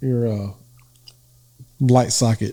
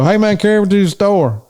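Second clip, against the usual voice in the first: higher, hammed-up Southern holler; 8 semitones higher than usual. Southerner asking for a lift up north, hammed-up Southern accent. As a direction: imitate a casual Southern ask. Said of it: "how you change your tone".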